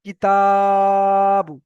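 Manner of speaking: কিতাবু with তা held long and loud, বু short; short কি, long ringing তা, short বু in কিতাবু; elongated TA. Shown as a demonstration. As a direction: stretch the middle of কিতাবু on one sustained pitch. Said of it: "ফাইনালে গিয়ে টান দেবো".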